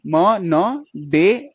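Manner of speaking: म, न, डे as separate beats; sounded out. Shown as a demonstration. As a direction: build the word slowly from म... न... डे. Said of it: "फिर आ रही डी". (म न डे, एक-एक ध्वनि अलग)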